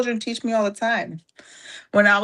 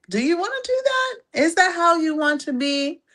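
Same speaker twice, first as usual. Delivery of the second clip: mock incredulous; 11 semitones higher than usual. Rhetorical mocking question, high voice.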